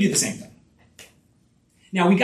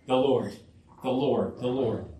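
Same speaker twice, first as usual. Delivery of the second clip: "the Lord" three times, low and soft, spaced apart; emphatic repetition; 7 semitones lower, 6 dB quieter than usual. Shown as a demonstration. Preacher showing the blessing's source emphasized three times, hushed emphatic repetition. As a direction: let each "Lord" drop quietly into its own pause. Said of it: "it's emphasized three times".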